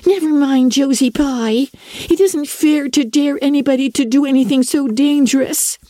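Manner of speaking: put-on high, anxious girl's plea; worried young Diana. A narrator's reading. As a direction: warn her friend, pleading. Diana pleading, voiced by narrator.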